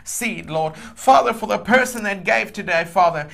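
impassioned preacher praying over a giver; fervent prayer. Real, not acted. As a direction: pray with full conviction, surging on key words. Prays aloud for a giver.

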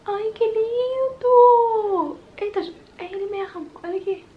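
very high, loud, slow drawn-out squeal; drawn-out squeal of joy; ecstatic squeal. Real, not acted. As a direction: stretch it out in pure delight. Gushing over blush swatch.

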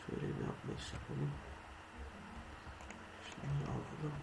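whispered under the breath. Real, not acted. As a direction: whisper to yourself while playing. Whispering while gaming. (fısıltıyla)

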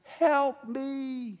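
lightly voiced scared little boy calling out; a hint of a frightened boy crying Daddy; child's cry for help. Father retelling his son stuck on the net, mild crying. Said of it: "And here's his cry".

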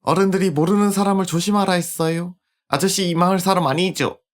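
a hint of a young girl; polite little girl voice; lightly voiced little girl.

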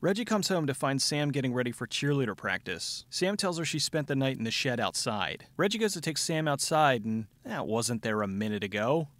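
normal talking voice

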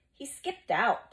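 high, loud, drawn-out grinning remark. A narrator's reading. Plubius guesses the teacher has fled, grinning. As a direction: say it through a grin.

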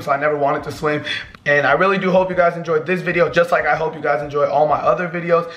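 ordinary talk, nothing marked

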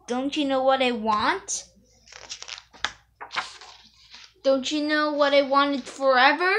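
lightly voiced pigeon, chatty and coy; pigeon voice read aloud. Dad reads the pigeon's lines to his daughter, lightly voiced. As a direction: read it like a wheedling bird.

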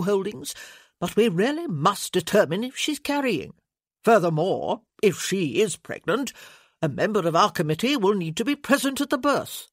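a lightly voiced pompous committee man; officious lord pressing his point; fussy lord. Lord Winston's voice.

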